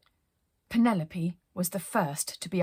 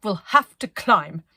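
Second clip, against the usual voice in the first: resolute order; 9 dB louder, slower than usual. Acted. Penelope orders climb.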